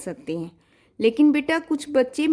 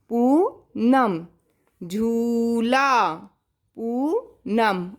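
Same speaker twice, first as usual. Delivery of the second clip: slow repeats; much slower than usual. Shown as a demonstration. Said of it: "repeats dictation words".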